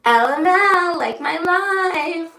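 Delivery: high, slow playful sing-song; playful quip. Retold or imitated. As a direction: tease it out slowly, high. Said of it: "And then I say".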